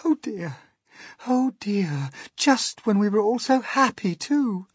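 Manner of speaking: high-pitched tearful lament; acted ladybird wailing; distressed cry. A narrator's reading. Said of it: "cried the ladybird".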